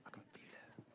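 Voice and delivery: breathy whisper, almost no voice. Real, not acted. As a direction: whisper it as a secret to the room. Hush at audience, back in room.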